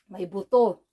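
ordinary delivery

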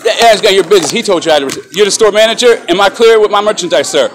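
loud, furious demand for an apology; angry shout. Real, not acted. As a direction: yell it at the guard, fed up. Shouting at the store manager.

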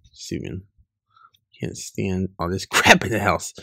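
ordinary talk, nothing marked

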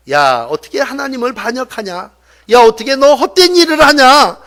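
scolding that builds to a loud, high cry; acted divine reproach, rising in force; indignant rebuke. Voices God rebuking the rebels.

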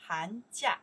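Han jia called loud with a rising jia; model pronunciation. Shown as a demonstration. Teacher leading the class drill, loud and clear. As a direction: say it out clearly for repetition. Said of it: "one more time".

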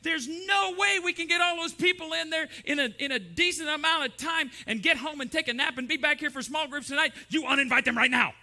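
loud, high-pitched exasperated tirade; bossy objector. Acted. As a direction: lay down the law at full volume. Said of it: "To which the red goes".

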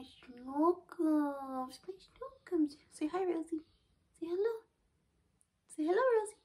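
sing-song coo at the dog. Real, not acted. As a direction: gush over a little pet. Baby talk to her dog.

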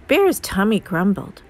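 ordinary delivery

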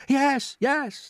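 high, loud agreement; eager chorus. A narrator's reading. Everyone answering the grasshopper, high eager cry. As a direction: chime in eagerly. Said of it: "they chorused".

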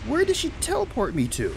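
high-pitched, bewildered outburst; shocked disbelief. Real, not acted. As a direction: voice shooting up in disbelief. Shocked at sudden teleport in game.

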